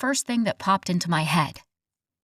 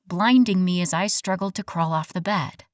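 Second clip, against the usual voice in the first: ordinary delivery, nothing marked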